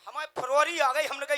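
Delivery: normal talking voice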